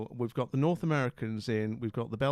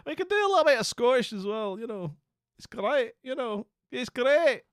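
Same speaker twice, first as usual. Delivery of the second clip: a light Scottish lilt, higher; mock Scottish accent; 9 semitones higher, 4 dB louder than usual. Streamer still playing at Scottish for the chat, light accent. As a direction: keep the playful Scottish voice going.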